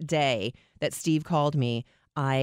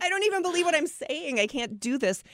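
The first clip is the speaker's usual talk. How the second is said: squeaky, much higher exasperated whine; miserable whine; 13 semitones higher than usual. Retold or imitated. Telling the host how she answered callers, in a high whine. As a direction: squeal it like you're at the end of your rope. Quoting her miserable past self.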